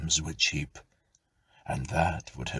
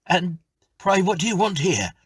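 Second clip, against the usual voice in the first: high, loud, fast frightened question; faltering fright; 13 semitones higher, 8 dB louder than usual. Acted. Scared tenant questioning the ghost, high and fast. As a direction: blurt it out high in fear. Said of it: "faltered the tenant".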